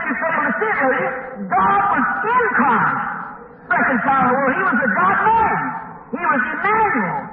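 emphatic sermon delivery with ringing key phrases; impassioned preaching. Retold or imitated. Preacher answering a doubting lady on Christ's deity, impassioned. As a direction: proclaim doctrine with rising fervour.

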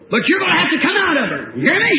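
high, forceful re-enacted order; commanding exorcist. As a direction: order the devil out with full authority. Retells commanding the devil out.

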